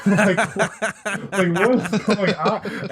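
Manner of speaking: can't believe it, laughing it out; laughing in disbelief. Real, not acted. Retelling a wild hotel story, laughing.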